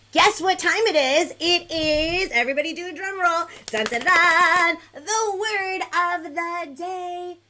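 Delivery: put-on booming kids' show reveal; game-show announcement. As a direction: announce the big segment with a vocal drum roll. Host announces the word segment.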